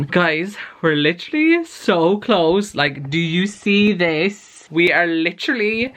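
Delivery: bursting with disbelief at the count; excited. Real, not acted. Three subscribers from ten thousand.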